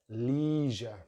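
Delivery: "leisure" as LEE-zhuh; "leisure" with long ee; American pronunciation. Shown as a demonstration. Teacher shows American vowel, "leisure" as LEE-zhuh. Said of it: "They have a long vowel sound".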